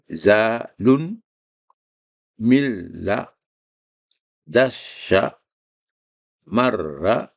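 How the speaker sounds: each syllable set apart, then the whole word; syllables brought out. Shown as a demonstration. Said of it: "faites un effort de sortir les syllabes". (syllabe puis mot entier)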